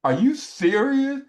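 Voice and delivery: retold incredulous protest; heated disbelief. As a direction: act out mounting outrage, stretching the words. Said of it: "I'm getting hot".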